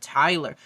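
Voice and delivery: "Tyler" said with the long I sound instead of Taylor; mimicking Pooch's pronunciation. Vlogger imitating how Pooch says Taylor's name. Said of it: "he would say it Tyler".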